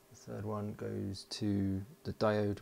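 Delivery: ordinary talk, nothing marked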